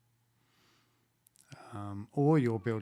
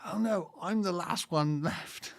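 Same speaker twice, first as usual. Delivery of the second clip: higher, drawn-out comic lament; mock dismay; 6 semitones higher, slower than usual. Comedian's dupe finds himself alone, mock dismay. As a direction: play the left-behind man's mock despair. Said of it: "he'll come out and go".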